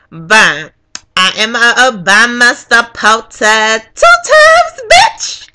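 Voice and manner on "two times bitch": high squealed tag word; triumphant. Real, not acted. Voter boasting of her vote, triumphant. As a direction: crow it at a high pitch.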